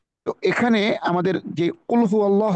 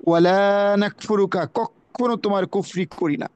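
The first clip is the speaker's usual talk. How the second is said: ordinary talk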